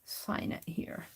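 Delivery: soft unvoiced murmur to herself; whispered aside; whisper to self. Real, not acted. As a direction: breathe it quietly while signing. Whispered aside while signing.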